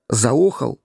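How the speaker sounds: plain reading voice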